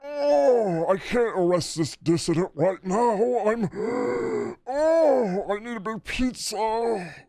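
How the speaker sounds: high, slow, moaning lumbering brute; Hulk. Acted. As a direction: groan and gasp in hammed-up agony. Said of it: "walking around like a thundering Hulk going".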